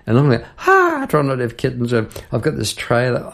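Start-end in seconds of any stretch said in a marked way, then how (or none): 0.6-1.0 sudden high yelp, much higher; startled yelp. Retold or imitated. Surprised by a huge huntsman in the sunlight, startled high yelp. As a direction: jump at the spider with a squeaky yelp. Spider suddenly found under tray.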